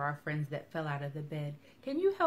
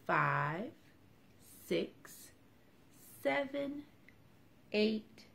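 slow counting aloud; 3 semitones higher, much slower than usual. Acted. Counting slowly for children.

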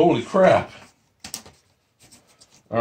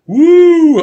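tipsy whoop; 8 dB louder than usual. Real, not acted.

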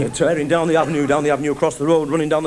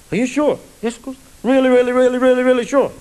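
higher, pleading voice of a nervous lad; anxious lad; 8 semitones higher than usual. Acted. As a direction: keep asking, really needing reassurance. Lad anxiously checking with girlfriend.

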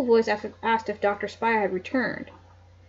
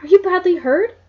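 anxious; 8 semitones higher, 7 dB louder than usual. A narrator's reading. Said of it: "Nancy exclaimed anxiously".

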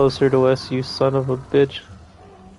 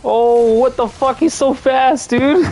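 excited shout; 9 semitones higher than usual. Real, not acted. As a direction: blurt it out, shocked and laughing. Monster suddenly appears.

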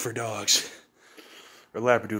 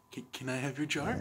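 quiet; 14 dB quieter than usual. Real, not acted. Quietly asking the dog.

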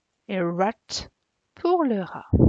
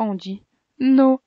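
"no" punched louder and higher; emphatic no; 3 semitones higher, 7 dB louder than usual. Shown as a demonstration. Teacher showing pupils how to refuse: "no" said louder and higher. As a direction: hit the word "no" hard, like a refusal. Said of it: "Et si on n'aime pas".

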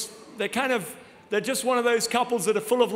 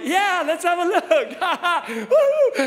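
eager and laughing; 8 semitones higher, 6 dB louder, much slower than usual. Retold or imitated. Eager to watch the hidden camera.

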